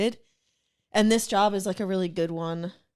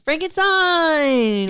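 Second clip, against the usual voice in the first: dramatic announcement; 8 semitones higher, 7 dB louder, much slower than usual. Real, not acted. Echoes card name dramatically.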